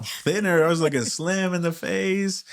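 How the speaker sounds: pleased giggle through the words; bright laugh on slim in the face. Real, not acted. Proud of looking slimmer.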